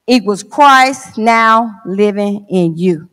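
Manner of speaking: solemn spoken poem, each phrase falling low at its end; poem recital. Acted. Recites own poem to congregation.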